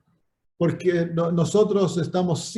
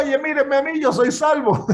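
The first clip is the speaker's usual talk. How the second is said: higher, showy call for attention; mock boasting; 8 semitones higher than usual. Preacher mocks a boastful believer, higher and showy. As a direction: play the show-off shouting he is saved. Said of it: "andarle gritando a todos".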